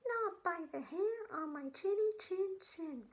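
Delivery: little pig refusing the wolf. Acted. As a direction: squeak it out like a small defiant pig. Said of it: "And the pig said".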